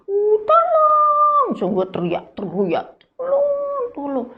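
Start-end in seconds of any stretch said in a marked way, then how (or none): 0.1-1.5 high, slow, wailing cry for help. Acted. Passengers crying for help. 3.1-4.3 high cry fading out; calling for help. Acted. Passengers crying for help.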